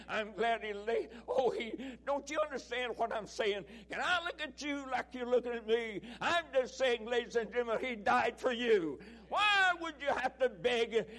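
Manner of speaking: fervent, ringing pulpit preaching; preaching at full force. Acted. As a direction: preach it out to the whole church. Preaches to the congregation.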